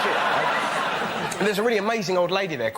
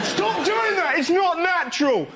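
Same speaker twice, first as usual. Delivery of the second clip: outraged passenger shout; 13 semitones higher, slower than usual. Pretend. Passenger yelling at kissing men on plane, high outraged shout. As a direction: scream at the couple to stop.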